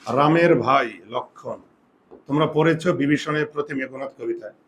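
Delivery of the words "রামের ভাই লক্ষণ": slow, drawn-out teaching read; careful slow model phrase; slow demo. Shown as a demonstration. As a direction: say it slowly for the class. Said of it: "এটার উচ্চারণটা কি জানো?".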